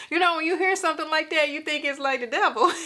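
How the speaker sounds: usual speaking voice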